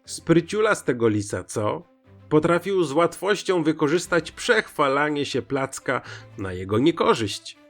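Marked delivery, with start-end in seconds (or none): none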